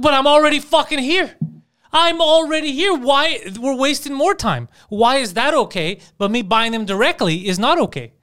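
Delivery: loud, high-pitched retelling of a fed-up customer; exasperated protest. Retold or imitated. Buddy protests to store clerk over online-only rule, exasperated. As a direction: argue it indignantly, stretching out the complaint. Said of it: "my buddy was so pissed off".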